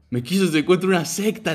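high and loud, laughing through it. Retold or imitated. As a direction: retell the drunk claim while cracking up. Retold drunk claim, laughing. (riendo, agudo y fuerte)

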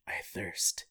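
breathy whispered croak; thirsty Jesus whispering softly; faint whisper. Retold or imitated. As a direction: whisper it like a dying man. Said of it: "Jesus before receiving a wetted sponge".

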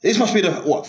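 usual speaking voice

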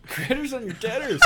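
laughing repeat. Real, not acted. Friend repeating the phrase with a laugh. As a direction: savour the silly phrase.

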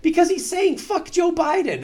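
very high, loud retort; imitated shouted explanation. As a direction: yell the answer over the chaos. Shouted answer in retold cabin argument.

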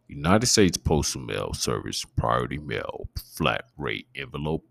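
slow, deliberate sample narration of junk mail; audiobook read-aloud. Shown as a demonstration. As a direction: read the mailer like an audiobook audition. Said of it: "do a little preview of that shit".

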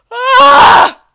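loud high-pitched outburst scream; short explosive shriek; sudden scream. Real, not acted. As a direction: let out a piercing yell. Frustrated scream mid-ramble.

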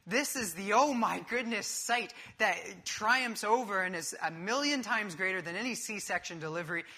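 ordinary delivery

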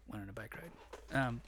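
ordinary talk, nothing marked

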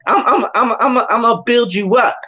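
high, stammering fervour building momentum; impassioned acted promise. God promising to build up his chosen player, impassioned. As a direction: promise greatness in a high, rousing voice.

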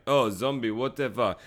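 low-pitched, unbothered shrug; mock nonchalance, dropped in pitch; casual indifference. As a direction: toss it off as if zombies were nothing. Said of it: "so casually turns around".

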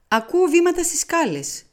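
high, eager alert; alert announcement. A narrator's reading. Poirot hearing a client on the stairs, eager alert. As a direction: perk up at the sound of steps.

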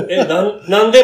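loud, laughing startled why; startled blurted question. Retold or imitated. Guest retelling the check moment, loud laughing surprise. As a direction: blurt it out, caught off guard and amused. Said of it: "まで聞こえた".

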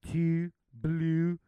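mock Baltimore oo sounds; Baltimore accent. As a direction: imitate the Baltimore "oo". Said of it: "they say, like".

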